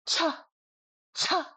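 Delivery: "cha" pitched high, with a blast of air; high, forceful airy "cha"; hard high-tone CH. Shown as a demonstration. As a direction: punch out "cha" heavily aspirated. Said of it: "high tone and way too hard".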